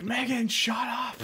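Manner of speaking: high, drawn-out mock yell; mocking quote. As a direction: mimic the other team telling her off. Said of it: "they were saying".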